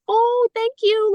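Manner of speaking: high, slow, drawn-out surprised thanks; pleasantly surprised. Retold or imitated. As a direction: stretch it out, high and delighted. Surprised reply to daughter.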